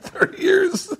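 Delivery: very high, incredulous squawk; mock outrage. Teller repeating the older driver's words, mock outraged. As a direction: imitate the indignant driver in a high voice. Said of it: "That's what he said to me".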